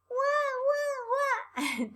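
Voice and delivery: put-on high wailing wah wah of a baby; playful high baby wail; baby crying sound. Shown as a demonstration. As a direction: imitate a crying baby for kids. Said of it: "¿y el niño cómo hace?".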